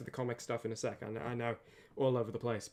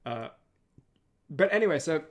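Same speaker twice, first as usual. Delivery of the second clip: usual speaking voice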